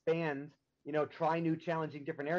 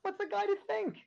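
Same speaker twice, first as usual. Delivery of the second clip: much higher, playful; mock innocent. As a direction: feign innocence.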